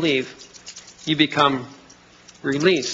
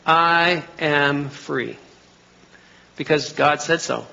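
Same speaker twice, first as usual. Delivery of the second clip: slow self-affirmation; slower than usual. Retold or imitated. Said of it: "tell myself in the mirror".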